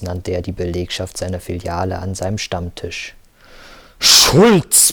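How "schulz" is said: high, loud roar of a surname. A narrator's reading. Boss roaring at employee entering the room, loud bellow. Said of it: "brüllte er".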